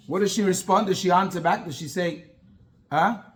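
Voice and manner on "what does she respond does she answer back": ordinary talk, nothing marked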